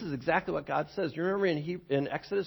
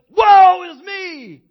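shouted, drawn-out wail of despair; dramatic cry; 11 semitones higher, 18 dB louder, much slower than usual. Acted. Preacher crying out as Isaiah before God. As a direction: cry it out slowly like a doomed prophet.

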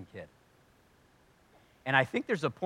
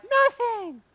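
put-on squeaky, much higher kid voice; eager kid; 6 dB louder than usual. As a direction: pipe up like an eager child. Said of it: "you'd be like".

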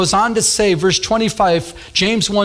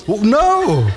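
comic dismay; 4 semitones higher than usual. Retold or imitated. Acts horror at being caught.

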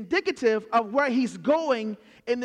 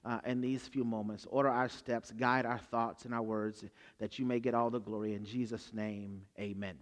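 low, soft, unhurried praying voice; prayer; 9 semitones lower, 9 dB quieter than usual. Acted. Preacher praying over the congregation, soft and low. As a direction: hush it into a reverent prayer. Closing prayer before the sermon.

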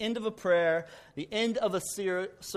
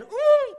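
high ooh of relief; 9 dB louder than usual. Retold or imitated. Horse stops at cliff.

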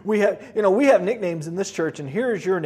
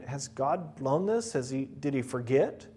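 worried musing; 7 semitones lower, 8 dB quieter than usual. Acted. Joshua's worried inner thoughts.

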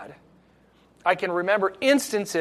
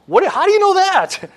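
imitating surprised dad; 12 semitones higher, 8 dB louder than usual. Dad surprised by son's knowledge.

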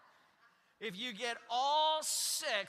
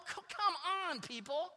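higher, pleading come on people; exasperated plea; 7 semitones higher than usual. Retold or imitated. Pastor mocking his own reaction to all-caps texters, exasperated. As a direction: throw up your hands at everyone. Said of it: "I'm going".

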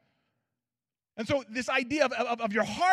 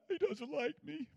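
mock sulking; 4 semitones higher, 8 dB quieter than usual. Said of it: "I'd have been like".

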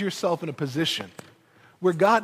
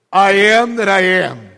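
much louder, booming; voice of God; 2 semitones lower, 9 dB louder than usual. Acted. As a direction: proclaim it like God.

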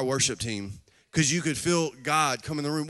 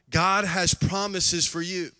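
normal talking voice

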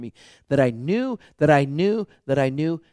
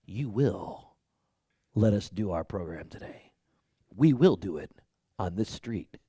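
a hint of hypnotic command, pitched low; measured, low persuasion with pauses; Jedi mind trick; 5 semitones lower, 3 dB quieter than usual. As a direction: lightly imitate a Jedi planting a suggestion. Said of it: "he performed a Jedi mind trick".

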